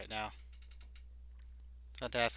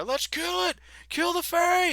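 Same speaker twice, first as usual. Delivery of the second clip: high, loud battle cry; bloodthirsty guard; 8 dB louder than usual. Acted. Guard urging the others to kill the fae.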